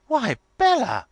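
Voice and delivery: very high, loud amazed cry; astonished exclamation. A narrator's reading. Lavinia stooping to inspect the dress, astonished exclamation. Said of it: "exclaiming again".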